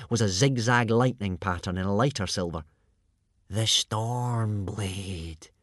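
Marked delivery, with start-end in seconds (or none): 3.5-5.5 very slow, awed utterance; long, reverent exhalation of the name; breathed in awe. A narrator's reading. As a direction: draw it out in wonder. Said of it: "breathed Baggy Bum the Beerbelly".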